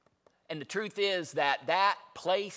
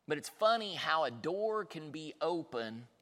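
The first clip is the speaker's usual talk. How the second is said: ordinary talk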